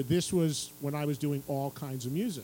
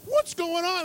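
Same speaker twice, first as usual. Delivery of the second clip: alarmed producers; 12 semitones higher, 4 dB louder than usual. Retold or imitated. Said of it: "they're like".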